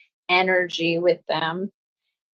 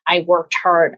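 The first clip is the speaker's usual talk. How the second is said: ordinary delivery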